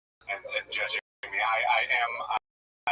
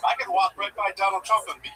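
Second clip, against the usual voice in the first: ordinary delivery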